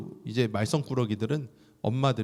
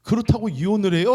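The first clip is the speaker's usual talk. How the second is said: high, loud disbelieving ask; incredulous question; 10 semitones higher, 8 dB louder than usual. Retold or imitated. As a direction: ask in shock, can't believe it. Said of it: "하면서 얘기를 하는데".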